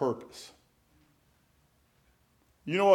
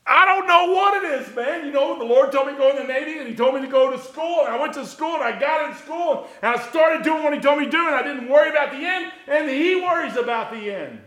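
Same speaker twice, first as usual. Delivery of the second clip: high, loud, fast preacher's cry; full-force preacher run; preaching at full force; 10 semitones higher, 6 dB louder than usual. Real, not acted. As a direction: preach it hard and high to the congregation.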